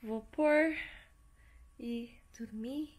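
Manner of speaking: sleepy, soft and drowsy; half asleep, winding down for bed; nodding off. Real, not acted. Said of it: "Tô morrendo de sono".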